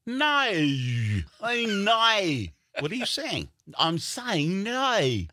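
high, drawn-out Australian no; Australian accent. Retold or imitated. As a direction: stretch the no, high and slow. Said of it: "Australian people, when they say no".

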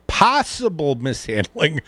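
"possible mishandling" drawn out slowly; labored, hard to say. Acted. Host repeating the line he choked on, "possible mishandling" slow and labored. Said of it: "I know it's hard to say".

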